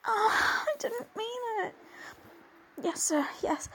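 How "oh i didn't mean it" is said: sobbing, higher voice; crying apology. Acted. Crying out an apology to her father. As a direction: cry the words out.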